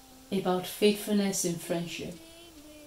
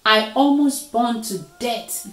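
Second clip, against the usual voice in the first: raised, louder complaint; aggrieved storybook cry; 4 semitones higher, 6 dB louder than usual. A narrator's reading. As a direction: exclaim it as an injured victim. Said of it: "Leopard cried".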